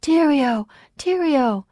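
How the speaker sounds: high, loud crowd cry; two high, falling cheers; calling out. A narrator's reading. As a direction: call the name out over a crowd, pitched high. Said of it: "Some people were calling out".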